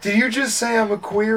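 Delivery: high, loud indignant question; hammed-up offended yell; mock screaming. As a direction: imitate an outraged defendant. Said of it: "screaming at each other".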